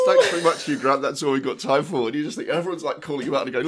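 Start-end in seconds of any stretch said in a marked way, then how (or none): 0.0-2.0 high, loud, chirpy host wrapping up; a hammed-up cheery host cutting the interview short; forced bright sign-off. Interviewer dying inside, ending the chat with Hugh Grant in a loud bright voice. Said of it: "And she goes".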